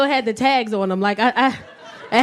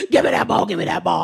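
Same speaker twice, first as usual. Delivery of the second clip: put-on low, aggressive toddler demand; mock-menacing low chant; 6 semitones lower than usual. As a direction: imitate a pushy little kid, dropping low. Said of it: "he's so aggressive like this".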